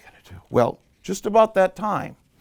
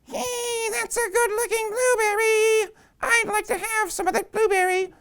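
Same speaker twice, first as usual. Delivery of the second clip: very high, tiny whining insect voice; mosquito. Acted. Mosquito asks for some blueberry, very high whine. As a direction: squeeze it up into a thin little mosquito squeal. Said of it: "And she says".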